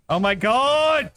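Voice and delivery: loud slow excited cry; excited shout. Real, not acted. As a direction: yell it in triumph. Finally beats the boss.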